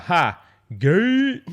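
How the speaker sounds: high, loud sitcom-style jeer; mock yell. Reading out dad's reaction to his son coming out, mock yell. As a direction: yell it out, swooping up high on the last word. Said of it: "I laughed and yelled out".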